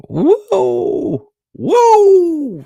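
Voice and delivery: high falsetto "whoa", drawn out and loud; mock amazed whoop. Comic reader marvelling at numbered pages, mock amazed whoop.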